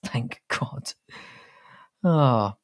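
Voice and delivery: breathless whispered laugh. Real, not acted. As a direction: chuckle it out under the breath. Relieved joke after accent talk.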